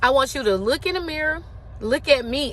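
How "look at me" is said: ordinary talk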